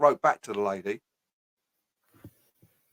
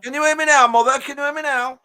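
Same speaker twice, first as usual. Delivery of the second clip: comedian's catchphrase; 13 semitones higher, 7 dB louder than usual. Acted. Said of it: "it comes from a comedian back in the day".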